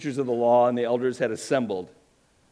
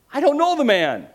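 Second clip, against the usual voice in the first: much higher, louder oath; sworn denial; 14 semitones higher, 8 dB louder than usual. Acted. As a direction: swear it hard. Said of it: "denied it again with an oath".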